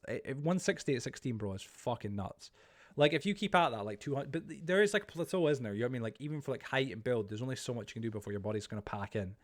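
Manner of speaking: ordinary talk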